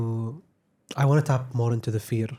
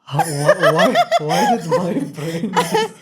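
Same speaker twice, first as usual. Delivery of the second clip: high laughing fluster over a flubbed word; laughing at himself; 6 dB louder, slower than usual. Real, not acted. Host laughs at his own slip while introducing guest, laughing. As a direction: laugh through the stumble.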